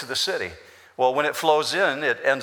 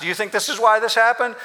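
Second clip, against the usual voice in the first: mock question; 8 semitones higher, 3 dB louder, faster than usual. Jesus restating his question mockingly.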